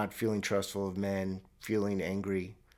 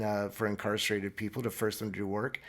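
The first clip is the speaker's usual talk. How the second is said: normal talking voice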